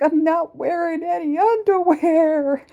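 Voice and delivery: high loud drugged outburst; groggy blurted confession. Retold or imitated. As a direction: blurt it out loudly, still half under anesthesia. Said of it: "I'm like".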